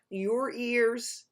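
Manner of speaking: ordinary delivery, nothing marked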